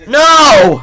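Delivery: high, loud alarmed no; sudden shout. Real, not acted. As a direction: yell as something falls. You dropped it!.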